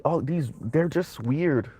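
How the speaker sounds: usual speaking voice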